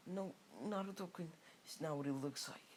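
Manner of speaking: high-pitched, lightly acted pleading; mock panicked denial. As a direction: plead innocence, pitched well up. Hinata caught trying to kill.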